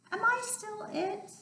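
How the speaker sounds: high, soft little fish voice; small surprised fish asking. Acted. Little fish from Sammy's belly.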